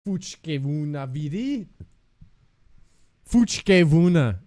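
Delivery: slow mock Russian "Fuchke vuna"; imitating Russian lady. Streamer repeating the Russian lady's words, slow mock accent. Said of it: "Fuchke is what she said".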